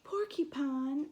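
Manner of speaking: ordinary delivery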